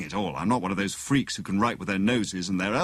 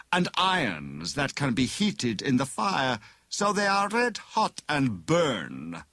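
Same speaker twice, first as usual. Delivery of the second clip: high, slow villain savouring each threat; threatening villain; 7 semitones higher, slower than usual. A narrator's reading. Bogus inspector threatens flippant prisoner with torture, slow menace. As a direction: draw out the menace, foreign-tinged.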